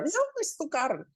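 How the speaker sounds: a sighing go-ahead; God permits the swap with a shrug; resigned God. Acted. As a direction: let him swap, resigned.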